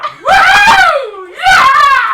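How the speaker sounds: loud, high whooping; real cheering. Real, not acted. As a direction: whoop at full volume in celebration. Game master cheering at the table.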